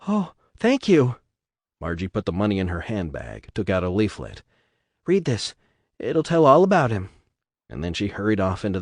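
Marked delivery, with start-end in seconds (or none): 0.1-1.2 put-on high, slow, grateful young voice; timid girl's voice. Timid girl thanks the donor, high and slow. 5.1-7.1 put-on girl's voice. Girl hands over leaflet.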